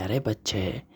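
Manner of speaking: put-on an old spirit calling him child; ring's voice. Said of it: "उस ring से आवाज़ आती है".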